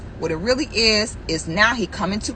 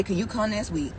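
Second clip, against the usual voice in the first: small, softer voice for the other woman; soft pleading imitation; 3 semitones lower, 8 dB quieter than usual. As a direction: drop low and coax, imitating her.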